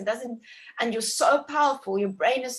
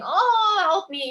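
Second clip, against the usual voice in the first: high, loud mock birth scream; 10 semitones higher, 7 dB louder, slower than usual. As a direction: wail it out, then drop for the plea. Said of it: "lying on my back and screaming".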